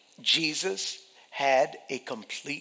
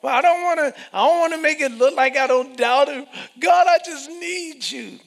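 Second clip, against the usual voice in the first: high, loud pleading cry; desperate believer; 12 semitones higher, 12 dB louder, faster than usual. Acted. Preacher voices a believer pleading with God, loud. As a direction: beg God loudly in a high voice.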